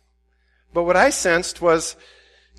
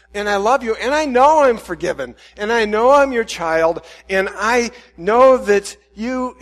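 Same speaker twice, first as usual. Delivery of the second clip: higher, louder pleading prayer; desperate prayer; 5 semitones higher, 6 dB louder than usual. Acted. Pastor pleading with God about his lukewarm heart, higher and louder.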